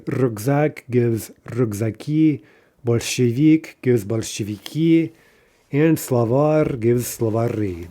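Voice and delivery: "rukzaki", "bolsheviki", "slavari" stressed slowly on the final i; stress on final syllables. Shown as a demonstration. Teacher modelling end-stressed plurals, "rukzaki", "bolsheviki", "slavari" stressed on the final i. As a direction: draw out each example, landing the last syllable of "rukzaki", "bolsheviki", "slavari". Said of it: "Those are also in-stressed nouns".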